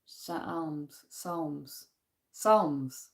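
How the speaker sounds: quiet repeated "Psalms" as "sahlmz" then "sawmz"; practising the word. Shown as a demonstration. Scottish vlogger drilling a hard word, quiet. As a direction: repeat "Psalms" softly, shifting the vowel. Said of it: "quite hard for a Scottish".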